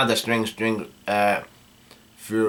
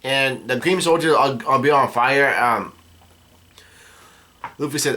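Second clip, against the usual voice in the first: ordinary talk, nothing marked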